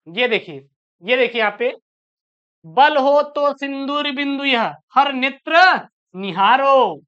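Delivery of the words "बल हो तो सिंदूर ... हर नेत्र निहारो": very slow, emphatic verse reading; poem recitation. Acted. Teacher showing students the answer in the poem, slow recitation. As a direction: stretch each word of the verse.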